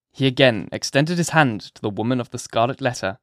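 ordinary delivery, nothing marked

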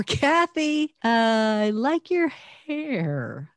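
high "Kathy", hesitant "uh", then slow "I like your hair"; doubtful, drawn-out "I like your hair", rising on "hair"; high-pitched, hesitating over "I like your hair"; different emphasis. Shown as a demonstration. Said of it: "just a total different emphasis".

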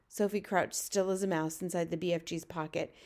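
usual speaking voice

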